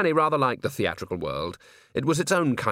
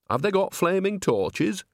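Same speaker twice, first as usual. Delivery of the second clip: higher, slow, knowing question; a lightly voiced old witch, curious; nosy old nanny; 8 semitones higher, slower than usual. Nanny asks the crowd.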